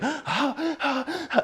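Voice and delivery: very high, breathless mock panic; panicked gasps. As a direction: gasp like a panic attack hitting. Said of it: "panic attacks".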